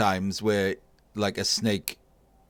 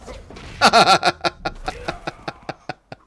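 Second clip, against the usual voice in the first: sudden burst of laughter; loud laugh; 8 semitones higher, 6 dB louder than usual. Real, not acted.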